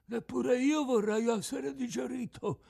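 very high, out-of-tune fish voice; cracked tuna voice. A narrator's reading. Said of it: "una vociaccia fessa di chitarra scordata".

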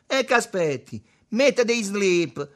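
high, loud goading; jeering dare. A narrator's reading. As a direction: egg him on at full voice. Il Buddha lo incita con scherno. (incitamento gridato e canzonatorio)

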